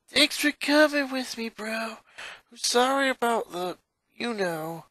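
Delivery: high, gentle, apologetic voice; lightly voiced awkward apology; sheepish older sister. Older sister thanking her brother after the concert, sheepish. Luna voice in story.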